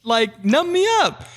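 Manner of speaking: very high, exasperated retold complaint; squeaky-high mock outrage; incredulous protest. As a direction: protest in hammed-up disbelief. Retold protest at second drilling.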